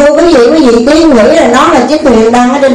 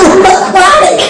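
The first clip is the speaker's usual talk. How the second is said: laughing through the words, pitched up; 8 semitones higher than usual. Real, not acted. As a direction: breaks into laughter mid-line. Teller laughs at her own tale.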